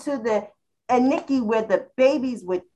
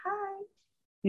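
flirty hi; 11 semitones higher, 10 dB quieter than usual. Pretend. Greeting the men, high and breathy.

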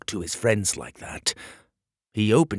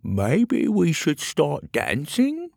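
timid high voice; 8 semitones higher, 3 dB louder, slower than usual. Acted. Petey nervously suggests dancing.

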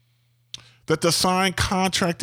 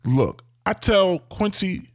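normal talking voice

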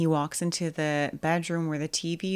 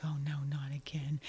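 soft, unvoiced whispered dread; little girl whisper; 9 dB quieter than usual. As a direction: whisper it like a scared small girl. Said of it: "she's like".

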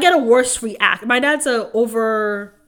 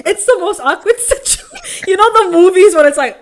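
high, loud talk through laughter; amused, pitched-up telling; laughing; 7 semitones higher, 7 dB louder than usual. Real, not acted. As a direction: crack up while telling it. Cracking up over awkward crying.

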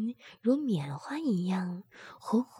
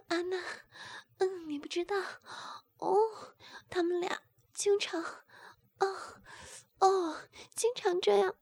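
moaning; 9 semitones higher, faster than usual. A narrator's reading. Sister answering her friend, high strained moans. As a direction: moan high through every phrase. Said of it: "吴敏呻吟道".

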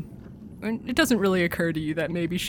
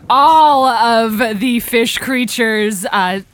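rising, louder and slower storytelling; dramatic narration; 8 semitones higher, 7 dB louder than usual. A narrator's reading. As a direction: build the horror of the swarm. DM narrates fish swarming, dramatic.

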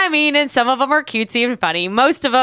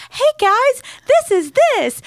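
much higher, bright gentle show voice; kids' TV host; 13 semitones higher, slower than usual. Shown as a demonstration. Host pitching a kids' show to older generations, higher. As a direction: talk like a children's show host. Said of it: "really gently and calmly".

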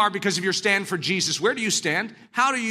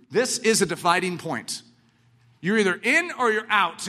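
normal talking voice

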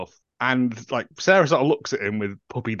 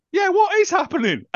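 baffled; 3 dB louder than usual. Retold or imitated. Her reaction to the screaming.

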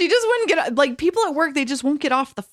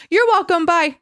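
high and louder chirpy goodbye; bright phone farewell; 9 semitones higher, 5 dB louder than usual. Retold or imitated. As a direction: say it brightly, then hang up. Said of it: "I'm like".

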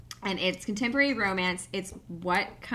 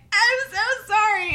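teary apology; 13 semitones higher, 6 dB louder than usual. Retold or imitated. Bride turns to groom crying.

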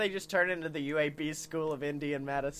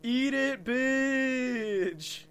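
higher, cocky taunt; mock taunt; 6 semitones higher, much slower than usual. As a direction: shove it at them, gloating. Playful taunt with bread.

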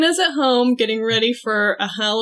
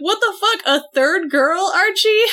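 put-on outraged girls; 6 semitones higher than usual. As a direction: demand it in high outrage. Girls outraged in retold comic.

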